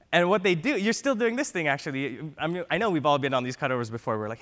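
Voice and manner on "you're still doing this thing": normal talking voice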